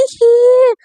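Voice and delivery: high, bubbly shout for parents; bubbly overjoyed call; giddy joy. A narrator's reading. Sakshi dancing with joy.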